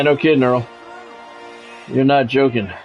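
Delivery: normal talking voice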